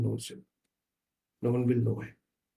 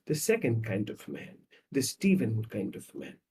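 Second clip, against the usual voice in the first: normal talking voice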